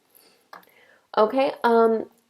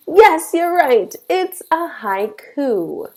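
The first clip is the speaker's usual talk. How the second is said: higher and louder, playfully excited; mock delight; 8 semitones higher, 6 dB louder than usual. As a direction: cheer the imaginary student on. Teacher praises imagined guess.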